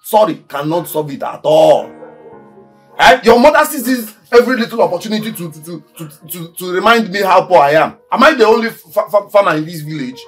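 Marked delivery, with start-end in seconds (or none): 3.2-6.3 fast, high-pitched indignant rant; angry complaint. Acted. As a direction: rattle off the grievance in rising anger. Angry complaint about her mother.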